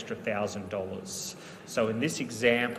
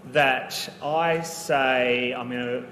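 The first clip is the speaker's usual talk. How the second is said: usual speaking voice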